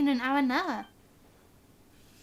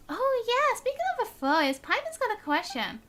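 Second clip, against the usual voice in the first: Paimon voice; 7 semitones higher than usual. Acted. Paimon's line voiced in game.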